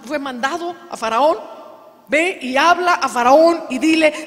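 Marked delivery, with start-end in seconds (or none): 2.1-4.3 booming divine command, much louder and higher; shouting voice of God at full force; God's commanding shout. Acted. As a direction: thunder it out as God sending Moses. God sends Moses to Pharaoh.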